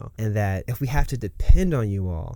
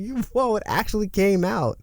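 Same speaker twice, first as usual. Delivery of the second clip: much higher, caught off guard by his own power; shocked freak-out; 10 semitones higher than usual. Retold or imitated. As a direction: gasp it out in disbelief. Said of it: "He's freaking out himself".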